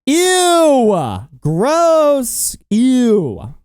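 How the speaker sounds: high squeal of disgust. Real, not acted. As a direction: recoil in mock revulsion. Reacting to bisexual news.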